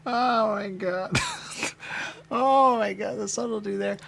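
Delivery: high, laughing through the words; laughing disbelief. Real, not acted. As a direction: crack up mid-phrase. Laughing at the game.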